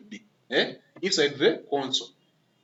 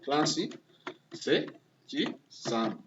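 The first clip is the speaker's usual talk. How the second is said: spelled out slowly; 2 semitones lower, 7 dB quieter, much slower than usual. Shown as a demonstration. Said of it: "my name is Clancy".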